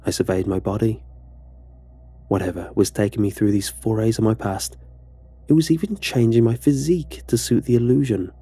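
usual speaking voice

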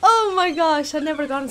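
loud, high burst of joy; thrilled. Real, not acted. Gamer overjoyed at finding clay, loud happy gasp. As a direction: let the delight spill out.

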